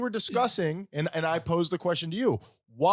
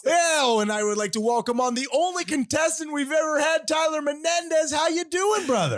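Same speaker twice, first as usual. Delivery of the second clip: game show host voice; 7 semitones higher than usual. Acted. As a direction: ham it up as a TV host. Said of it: "ridiculous voices on it".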